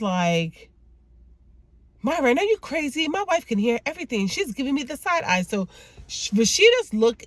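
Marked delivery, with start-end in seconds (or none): 2.0-5.4 high-pitched, rattled comeback to a caller; high, frantic telling-off, played for the retelling; acted indignant panic. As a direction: act out a flustered husband afraid of being overheard. Pastor hissing back at caller, acted.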